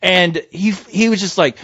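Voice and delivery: ordinary delivery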